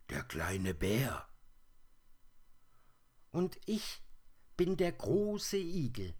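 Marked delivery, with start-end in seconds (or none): 3.3-6.2 hoch und laut geknurrt, grantig; knurrender Igel. Acted. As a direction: knurr es wie ein stolzer, mürrischer Igel. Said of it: "knurrte die Stimme". (der Igel knurrt hoch und laut)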